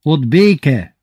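high, loud, slow call; cheerful shout. A narrator's reading. Old farmer answering that guests bring peace, cheerful shout. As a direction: shout it merrily from the cart. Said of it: "kiáltott az öreg vidáman".

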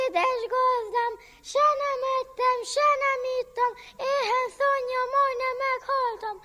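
very high, thin goat voice; bleating goat. Acted. Goat's lying answer.

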